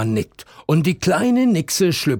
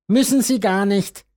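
put-on cheeky girl; 9 semitones higher, 4 dB louder than usual. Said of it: "sagt Melusinchen".

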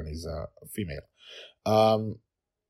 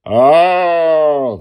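long, high, very loud pained roar; monster roaring; 12 semitones higher, 18 dB louder than usual. Acted. Goblin monster hurt by the rocket launcher, roaring. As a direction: roar as the toe is smashed. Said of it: "the goblin monster roared".

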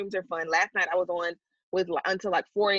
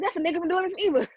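excited; 11 semitones higher, much faster than usual. Real, not acted. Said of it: "excited recognition".

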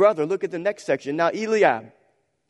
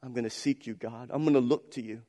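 quiet cry to God; 5 semitones lower, 8 dB quieter than usual. Acted. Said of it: "Crying out".

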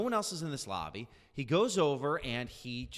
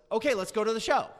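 sudden brisk; 7 semitones higher, 7 dB louder, faster than usual. Retold or imitated. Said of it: "he just goes".

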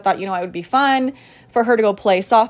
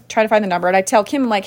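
normal talking voice

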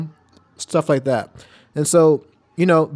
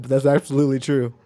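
normal talking voice

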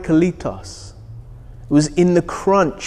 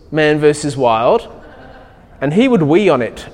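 ordinary delivery

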